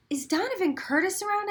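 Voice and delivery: put-on high, eager question to a boy; asking. A narrator's reading. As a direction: call out to a stranger. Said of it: "I asked".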